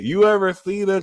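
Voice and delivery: usual speaking voice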